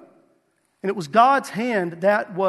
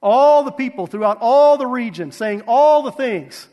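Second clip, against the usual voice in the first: every "all" punched louder and falling; emphasis on all; 2 semitones higher, 7 dB louder than usual. Shown as a demonstration. Preacher stressing "all" to his congregation, punched loud. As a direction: lean hard on each "all". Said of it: "Did you notice the emphasis on the word all?".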